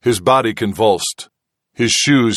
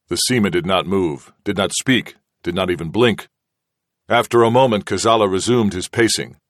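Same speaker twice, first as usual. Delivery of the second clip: ordinary delivery, nothing marked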